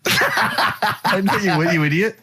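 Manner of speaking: normal talking voice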